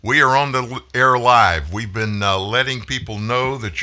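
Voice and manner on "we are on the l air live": loud, raised on-air call; shouting into a phone. Real, not acted. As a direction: project over a bad phone line. Host shouting to caller on phone.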